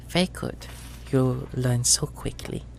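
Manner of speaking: sweet, soft, menacing auntie voice; treacle voice. Acted. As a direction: threaten sweetly and quietly. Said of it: "treacle voice".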